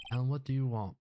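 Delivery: put-on grand lawyer in a Southern accent. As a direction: play it as a pompous Southern gentleman. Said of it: "giving everybody southern accents".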